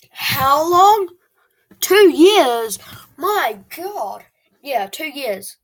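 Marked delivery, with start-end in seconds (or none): 0.1-4.2 loud, high, fed-up cry; exasperated outburst. Real, not acted. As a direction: throw it out in disbelief. Exasperated at others' subscriber counts.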